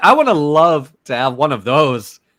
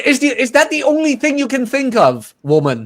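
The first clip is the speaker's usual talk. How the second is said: high mock woman; 8 semitones higher than usual. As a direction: play the offended girlfriend, high. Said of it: "acted woman's complaint".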